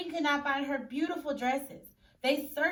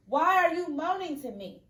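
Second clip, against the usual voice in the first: annoyed king; 6 semitones higher, 8 dB louder than usual. Acted. King shouting at villagers.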